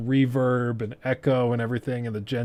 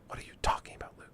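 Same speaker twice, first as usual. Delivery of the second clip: whispered aside; 12 dB quieter, much faster than usual. Real, not acted. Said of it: "self-address aside".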